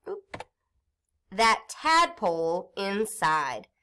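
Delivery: slow, careful sentence reading; reading aloud slowly. Shown as a demonstration. Teacher reading practice sentence to class, slowly. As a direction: read each word clearly for young listeners. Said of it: "Listen as I read the sentence".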